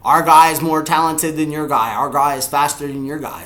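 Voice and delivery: loud taunt easing off; taunting challenge. Acted. Fan voicing a challenge to rivals, loud taunt. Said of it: "we should line up man-to-man and just say".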